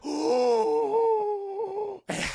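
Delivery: high, loud mock gasp; shocked gasp. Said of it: "And everyone's like".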